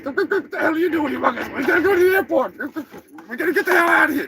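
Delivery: high, urgent turkey voice; pushy high-voiced turkey; impatient turkey character. Turkey argues to leave for airport.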